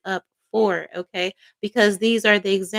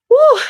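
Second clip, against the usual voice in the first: high, loud whoop of delight; excited whoop; 12 dB louder than usual. Real, not acted. Reader whooping with joy at the Ace of Swords. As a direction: let out a joyful whoop. Reader cheers about the card.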